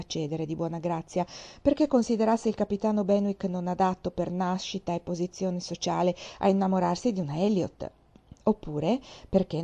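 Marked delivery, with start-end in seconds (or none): none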